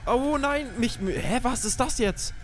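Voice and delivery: high panicked gamer voice; panicking gamer. Real, not acted. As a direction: panic as you get dragged off. Grabbed by enemy in game.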